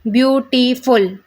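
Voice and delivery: Beautiful slowly in three parts, ब्यू-टी-फुल; syllable by syllable. Shown as a demonstration. Teacher giving a syllable example, beautiful split with gaps. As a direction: break beautiful after view and after टी. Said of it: "इसमें कितनी बार आपको gap लेना पड़ रहा है".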